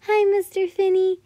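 put-on high, loud child's greeting; excited shout. A narrator's reading. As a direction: call out eagerly across the water. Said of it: "Hana shouted as she waved".

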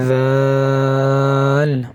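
দাল stretched slowly, tongue on upper teeth; slow dhal. Shown as a demonstration. Teacher showing the tongue position for দাল, slow. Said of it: "দুই দাঁতের আগার সাথে লাগিয়ে উচ্চারণ".

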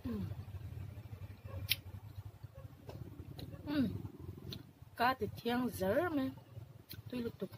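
speaking with food in mouth; chewy muffled words; talking while chewing. Real, not acted. Seen 0: Puts food in mouth at -2; cheeks/lips chewing through early frames.

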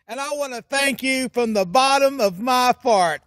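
ordinary talk

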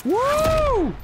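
high, loud whoop of triumph; victory whoop. Real, not acted. Gamer celebrates dropping a thirty bomb, loud whoop. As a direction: cheer the win.

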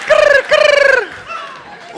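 high, loud trilled cricket chirp. Retold or imitated. Comic showing the insect's noise, high trilled chirp. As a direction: imitate a cricket, piercing and rolled. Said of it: "What is that sound?".

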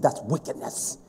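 whispered, soft disgust; hushed. Real, not acted. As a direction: drop to a whisper on wickedness. Hushed quoting of wickedness.

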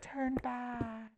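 high, soft eerie warning; a tiny ghostly bird warns you off; creepy birdie voice. Retold or imitated. Retelling Teen Titans birds, she does a high eerie voice. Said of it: "and they're like".

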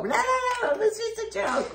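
very high, squeaky hurried no no no; frantic reassurance. Retold or imitated. Mom and kid stopping the dad, squeaky panicked high voice. Parents calling off the prank.

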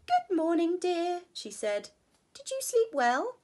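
high, loud bright wife voice; cheery Missus Bear. Acted. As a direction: greet him sweetly like Missus Bear. Missus Bear cheerful morning greeting.

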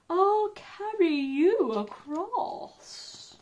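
slow, sly fox voice; crafty fox. A narrator's reading. Crafty fox sweetly offering the gingerbread man a ride. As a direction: lure him in slowly.

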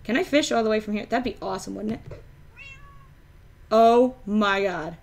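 ordinary delivery

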